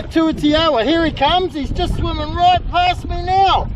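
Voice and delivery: ordinary delivery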